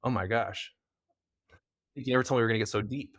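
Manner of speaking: normal talking voice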